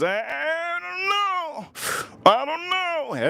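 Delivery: high, slow wailing whimper; mock anguish. Whiskey vlogger whining about pear in bourbon, high slow mock wail.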